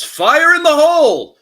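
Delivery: very high, loud battle yell; party member yelling a warning before the blast. Acted. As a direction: bellow it as the grenade flies. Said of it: "he yells".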